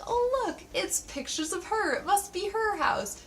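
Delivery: high mocking sleuth; mock discovery. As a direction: squeak out the clueless clue. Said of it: "he's like".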